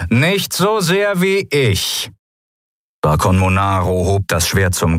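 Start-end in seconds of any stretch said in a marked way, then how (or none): 0.1-2.1 much higher, slow, drawn-out reply; high-pitched duelist's retort; taunting rival. A narrator's reading. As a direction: answer your enemy slowly in a raised, altered voice. Enemy admiral's reply before duel.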